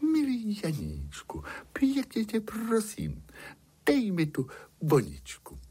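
high, sugary princess plea; honeyed storybook princess begging; sweetest princess voice. A narrator's reading. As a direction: plead as sweetly as you can, high-pitched. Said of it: "Princezna řekla tím nejsladším hlasem".

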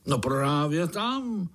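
high, drawn-out, self-satisfied confirmation; contented. A narrator's reading. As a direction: relish the answer slowly. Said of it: "řekl strýček spokojeně". (spokojeně, s gustem)

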